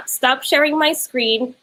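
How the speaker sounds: ordinary talk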